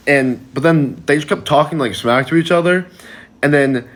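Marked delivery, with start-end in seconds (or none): none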